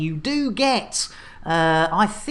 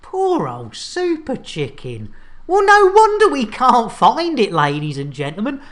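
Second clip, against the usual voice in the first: ordinary talk, nothing marked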